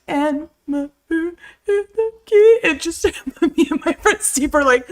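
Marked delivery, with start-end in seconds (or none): 0.1-2.6 high, slow, loud mock crying; crying child voice. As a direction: imitate a sobbing second-grader. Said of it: "She's like".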